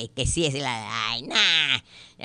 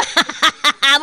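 high, loud guffaw; real laughter; 9 semitones higher, 12 dB louder than usual. Real, not acted. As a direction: crack up loudly. Le da risa la confusión. (carcajada aguda y fuerte)

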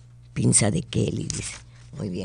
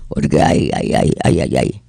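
mock pained groans; 12 semitones lower than usual. Host pretends sudden illness on air.

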